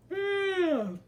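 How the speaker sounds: big drawn-out yawn; demo yawn. Shown as a demonstration. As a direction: yawn out loud for the kids. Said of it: "Everyone give me a big yawn".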